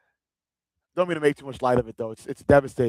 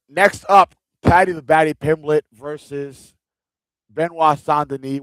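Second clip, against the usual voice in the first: hyped fight announcement; 3 semitones higher, 7 dB louder than usual. Real, not acted. To his live chat, announcing the next fight, loud and hyped. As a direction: call out the matchup like a ring announcer.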